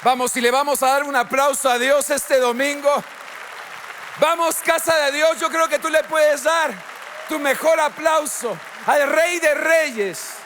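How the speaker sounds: high, driving call for applause; rousing rally call. Real, not acted. Preacher asking the church to applaud God, rousing rally call. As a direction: whip up the congregation.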